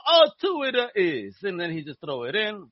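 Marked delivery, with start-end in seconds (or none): 0.1-1.3 loud, high-pitched Italian pizza guy; bad Italian accent. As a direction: call back the order in a mock Italian accent. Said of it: "sound like homie from the pizza shop".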